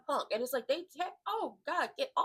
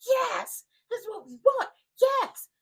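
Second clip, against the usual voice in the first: much higher and louder, overjoyed; ecstatic yes; 12 semitones higher, 11 dB louder, slower than usual. Retold or imitated. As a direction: cheer like your dream just came true. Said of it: "And John Cena is like".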